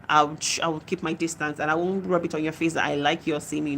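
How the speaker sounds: ordinary delivery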